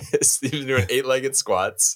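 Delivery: words pushed through laughter; giggling while telling it; real laughter in the voice; laughing. Real, not acted. Laughing through spider squat joke.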